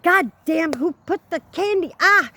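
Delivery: high-pitched, mildly yelled gripe; retold yell. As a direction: act out a startled outburst after tripping. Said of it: "like that kind of deal".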